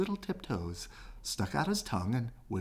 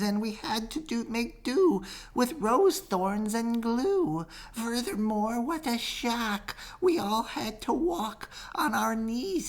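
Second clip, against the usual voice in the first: fretful bees; 12 semitones higher, slower than usual. Acted. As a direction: whine it slowly like a worried bee. Bees complain slowly.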